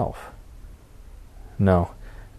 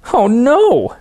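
much higher, louder panicked cry; shouting again; 7 dB louder than usual. Acted. Harold shouts again at God, panicking. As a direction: squeal it in rising panic. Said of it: "Harold shouts again".

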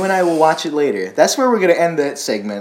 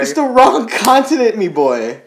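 excited; 9 semitones higher, 6 dB louder than usual. Acted. Teasing correction, mock accent.

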